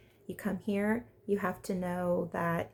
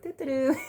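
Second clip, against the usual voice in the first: put-on clueless boy humming a tune, higher and louder; carefree high humming; 6 semitones higher, 5 dB louder than usual. Vlogger mimicking oblivious Lorenzo, high carefree hum. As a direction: hum it like nothing's wrong. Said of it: "He's like".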